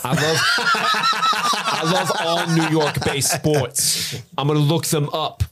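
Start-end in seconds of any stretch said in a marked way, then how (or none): 0.0-4.2 put-on slow, laughing New York accent. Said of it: "in that New York accent".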